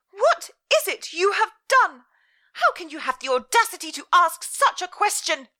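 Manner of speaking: high, loud, scolding mother; furious mother. Acted. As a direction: lay down the law, outraged. Mother's voice in story.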